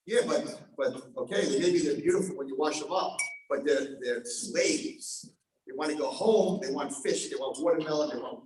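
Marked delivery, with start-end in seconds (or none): none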